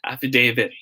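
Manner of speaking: affidavit as af-fi-DAY-vit, falling; long A in affidavit. Shown as a demonstration. Teacher confirming the answer, affidavit with long-A DAY. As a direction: model affidavit with a clear DAY. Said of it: "will be pronounced as day".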